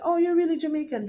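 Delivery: finally convinced, said quickly; surprised realization. Retold or imitated. Hotel staff realizing she is Jamaican, surprised.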